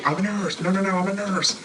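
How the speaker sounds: high urgent calling out, retold; reenacting her urgent shout; calling out urgently. Nurse calling to the family from the bottom floor, urgent. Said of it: "you could hear my voice in the video".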